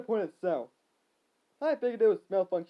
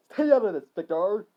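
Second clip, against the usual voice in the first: yell; 3 semitones higher, 9 dB louder than usual. Acted. Harry warning Victor off, yelling. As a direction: yell it sharply. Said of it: "Harry yelled".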